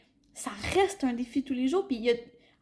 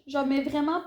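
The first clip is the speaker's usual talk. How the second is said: ordinary talk, nothing marked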